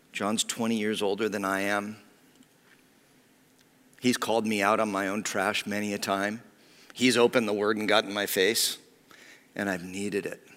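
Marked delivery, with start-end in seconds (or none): none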